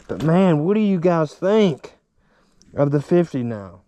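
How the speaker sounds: ordinary talk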